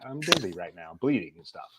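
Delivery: dry mock complaint, voice dropped low; bleeding patient scoffing at the police report; sarcastic retort.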